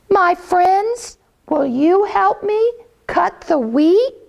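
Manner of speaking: high hen voice asking nicely; high, polite mother hen. Acted. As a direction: lift it up high and ask sweetly. Hen asks friends again.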